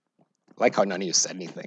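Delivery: ordinary delivery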